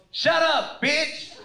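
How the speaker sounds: loud, high-pitched mock snap; retold road-rage shout; acted angry retort. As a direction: imitate a driver yelling back. Driver retorts at his wife.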